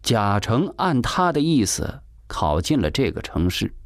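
ordinary delivery

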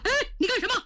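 high frantic shouting. A narrator's reading. Prince Taiping shouting at his attacker, high and frantic. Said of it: "太平王高喊".

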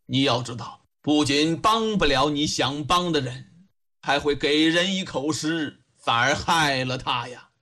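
put-on low, measured elderly official; deep-pitched, weighty reproof; old judge admonishing. Old judge warns the young woman her confession helps nobody, sternly admonishing.

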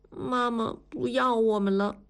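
put-on small plaintive child's voice; forlorn child. A narrator's reading. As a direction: say it as an abandoned little girl. Abandoned child voiced in story. (小女孩委屈地说)

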